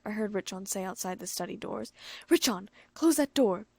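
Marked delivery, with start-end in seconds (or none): 2.3-3.7 louder, firm order; serious command. A narrator's reading. Shigure ordering Richon to shut the door, serious. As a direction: tell him to shut it, no joking. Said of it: "in a serious voice".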